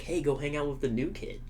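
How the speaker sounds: ordinary talk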